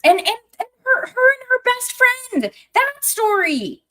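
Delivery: a fan overwhelmed by a character's backstory, high and loud; overwhelmed gushing. Real, not acted. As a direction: squeal it out as if it's almost too much. Gushing over a tragic story.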